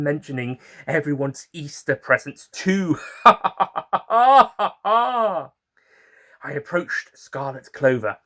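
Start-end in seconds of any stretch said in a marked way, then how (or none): 3.0-5.5 high, loud giggling laugh; delighted giggle. Real, not acted. Storyteller laughing about all the Easter presents. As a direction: let a bright laugh bubble out.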